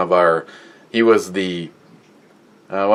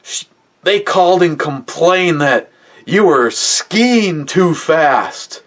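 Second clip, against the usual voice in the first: put-on much higher, slow strained angry old man; angry grandpa; 8 semitones higher, slower than usual. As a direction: spit out the aunt's name in fury. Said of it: "looks at me, he's like".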